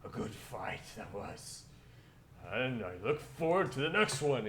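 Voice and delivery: hearty orc, rising to a big loud cheer; approving orc. Acted. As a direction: warrior praising a good fight. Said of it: "the orcs look at you with approval".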